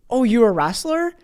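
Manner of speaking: light up at the news; surprised assistant. Retold or imitated. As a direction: assistant hearing a caller wrestled, surprised and thrilled. Assistant learns he wrestled.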